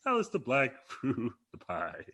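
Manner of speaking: slow rhyming Keith Lee impression, high then dropping; lightly put-on Keith Lee cadence. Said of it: "Keith Lee's like".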